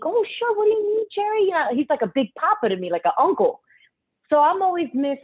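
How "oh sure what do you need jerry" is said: eager. Retold or imitated. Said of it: "letting the man speak".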